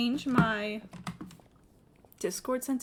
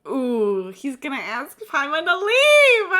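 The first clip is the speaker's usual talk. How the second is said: gleeful; 2 semitones higher, 10 dB louder than usual. Real, not acted. Streamer predicting the plot gleefully.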